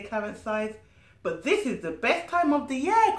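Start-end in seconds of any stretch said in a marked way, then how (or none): 1.2-3.2 excited protest. Acted. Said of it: "cries Nori".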